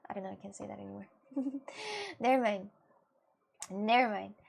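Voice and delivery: ordinary talk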